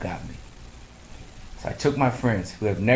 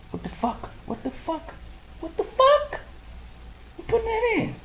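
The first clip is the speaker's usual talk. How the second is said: squeaky high outraged disbelief, climbing higher each time; high incredulous squeal; shocked disbelief; 4 dB quieter than usual. Retold or imitated. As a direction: shriek it in rising horror. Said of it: "And I'm like".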